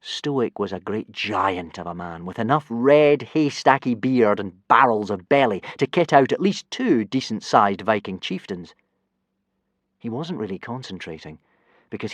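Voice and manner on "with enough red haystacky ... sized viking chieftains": plain reading voice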